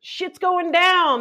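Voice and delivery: high, slow mock coyote talk; voicing the coyotes. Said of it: "they're like".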